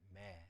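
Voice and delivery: "매" low and soft, with a faint vowel; weak "에" in 매. Shown as a demonstration. Teacher reads the shewa at word start, weak "매". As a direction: barely sound the 에 in "매". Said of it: "약간 에 발음해서 매라고 발음".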